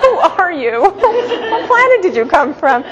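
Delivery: high, amused, laughing through the words; amazed mother laughing at her clever son; laughing disbelief. Retold or imitated. Mother's amused reply to her child.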